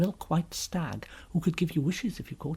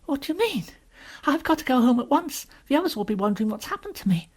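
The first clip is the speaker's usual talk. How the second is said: put-on high, anxious little girl; worried child pleading to leave, pitched up; rather frightened; 10 semitones higher, 3 dB louder, faster than usual. Lucy insisting she must get home, frightened. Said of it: "feeling rather frightened".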